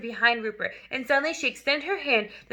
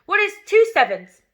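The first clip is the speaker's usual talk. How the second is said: much louder, higher demanding question; barked question; 8 semitones higher, 9 dB louder than usual. Acted. Headmistress demanding an answer from a pupil, loud and sharp. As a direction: fire the question like a threat. Trunchbull quizzes the boy.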